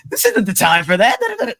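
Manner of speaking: high, loud mock reprimand; mock scolding. Recalling the clap moment in a movie, mock scolding. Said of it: "It was like".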